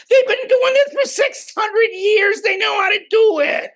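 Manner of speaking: loud, high-pitched exasperated outburst; emphatic rebuttal. Real, not acted. Speaker answering sceptics about the monks, loud and emphatic. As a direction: shout down the doubters, voice jumping up high.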